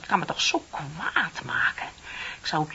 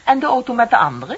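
sharp pressing question; 5 semitones higher, 11 dB louder, faster than usual. Acted. Pressing interrogation.

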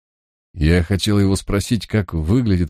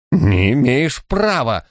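slow, high shrieking protest; high, drawn-out indignant squeal; 6 semitones higher, slower than usual. Acted. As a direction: squeal it out in panic. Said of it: "взвизгнул Соловьёв".

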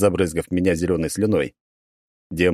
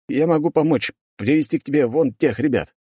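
fake eager pleading; 8 semitones higher than usual. Hero offers to betray family.

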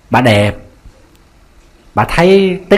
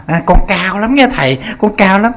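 a hint of a vain woman bragging, higher; boastful lady; 5 semitones higher than usual. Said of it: "bà cứ nói bà cao".

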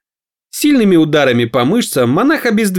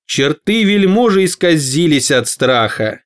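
ordinary delivery

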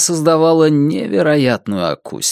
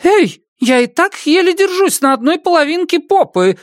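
yelling woman. Acted. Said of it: "завопила дама".

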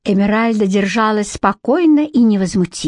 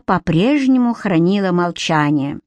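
ordinary delivery

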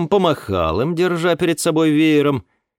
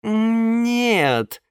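hesitant; 9 semitones higher than usual. Acted. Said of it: "замялась нимфа".

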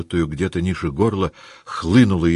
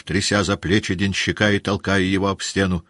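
usual speaking voice

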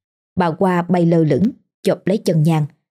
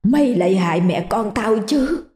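vengeful ghost; 3 semitones higher than usual. Acted. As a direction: hiss out her grievance. Ghost accuses Lành.

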